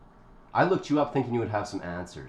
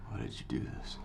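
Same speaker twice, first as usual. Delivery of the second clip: weak whisper; 12 semitones lower, 16 dB quieter than usual. Acted. Wakes up restrained.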